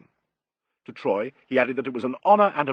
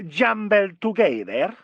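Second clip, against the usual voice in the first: put-on higher, drawn-out Italian accent; marked Italian accent; 7 semitones higher, 3 dB louder, slower than usual. A narrator's reading. Italian maestro asking a jet-lagged guest, marked Italian accent. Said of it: "spoke with a marked Italian accent".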